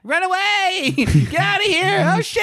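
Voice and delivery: high-pitched panicked yelp; hammed-up fleeing in a panic; mock panic. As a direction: squeal it out like a cartoon coward. Acted panicked yell in retelling.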